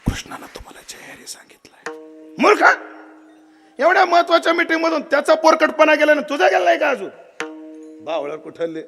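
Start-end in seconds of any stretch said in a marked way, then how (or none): 0.1-2.0 breathy secret whisper into the ear. Retold or imitated. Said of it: "कान इकडं करा". 2.4-7.1 irritated scolding. Retold or imitated. Balram scolds the messenger.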